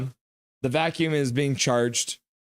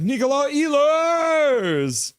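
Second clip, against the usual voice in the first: high, long-drawn name call; goal-scorer cheer; 13 semitones higher, 4 dB louder, much slower than usual. Acted. Streamer voicing a fan hailing the scorer, drawn-out cheer. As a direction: hold the surname high and long.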